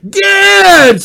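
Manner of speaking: high, loud cry of recognition; delighted shout. Acted. Lottie spotting her old friend, delighted shout. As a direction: shout the name in joyful surprise.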